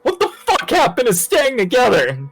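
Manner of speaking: high-pitched incredulous outburst; exasperated. Acted. Player scolding the party for splitting up, exasperated. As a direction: demand it in high, exasperated disbelief.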